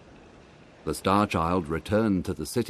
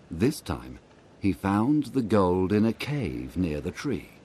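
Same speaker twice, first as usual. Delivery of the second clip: ordinary delivery, nothing marked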